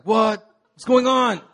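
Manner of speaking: high, loud burst of disbelief; shocked surprise. Retold or imitated. Man just told he'll be campus pastor, loud shocked disbelief. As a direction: react like the news caught you completely off guard.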